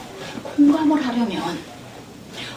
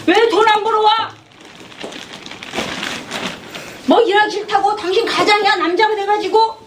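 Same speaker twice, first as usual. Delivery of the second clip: nagging wife scolding; 6 semitones higher than usual. Retold or imitated. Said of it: "이렇게 해왔다는 거죠".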